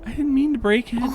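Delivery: put-on high-pitched, apologetic little kid; put-on child voice. Child confessing a breakage to mom, high squeaky voice. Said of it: "That was the worst voice I've ever done".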